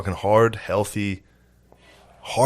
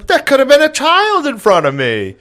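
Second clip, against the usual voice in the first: put-on scolding old woman; 5 dB louder than usual. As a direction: imitate an outraged old lady scolding a driver. Imitates angry old woman outside car.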